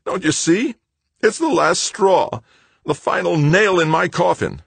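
high, loud, anguished wail; desperate, voice pushed right up; near tears. A narrator's reading. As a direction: plead as if about to break down. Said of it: "about to dissolve in tears".

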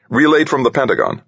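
high-pitched radio relay; businesslike controller, pitched well up; flight controller. A narrator's reading. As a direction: answer like a controller over the radio. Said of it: "the flight controller called".

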